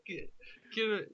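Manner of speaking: imitated yell, pitched well up; retold yelling. Telling friends how he called out, high mock yelling. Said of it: "he's yelling".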